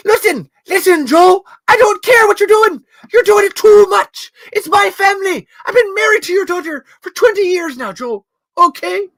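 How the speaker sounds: very high, loud shouting Irish rant; an Irish husband yelling at Joe; ranting Irish accent. Retold or imitated. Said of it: "I gotta think of the impression".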